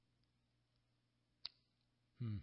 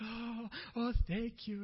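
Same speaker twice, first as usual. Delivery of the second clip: tearful thanks; 8 semitones higher, slower than usual. Retold or imitated. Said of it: "tears coming down".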